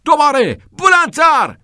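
high, very loud orders; shouted command. A narrator's reading. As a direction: bellow to push him harder. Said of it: "کتینگ داد زد".

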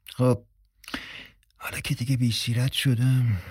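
soft resigned aside; to himself. A narrator's reading. Dalen talking to himself after being seduced, soft aside. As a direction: think aloud under the breath. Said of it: "دالن با خودش گفت".

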